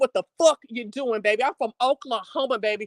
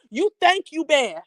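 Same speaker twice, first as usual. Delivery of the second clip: ordinary delivery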